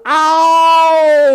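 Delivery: high loud yelp; yelled. Acted. Goldilocks landing on the hard big bed, loud yelp. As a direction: yelp it out sharply.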